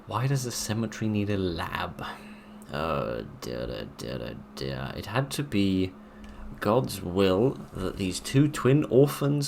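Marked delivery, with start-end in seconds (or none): 2.7-4.9 1 dismayed murmur. Real, not acted. Reacting to grim document.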